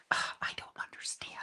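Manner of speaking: a groaning, breathless under-the-breath complaint; muttering at the app; frustrated whisper. Real, not acted.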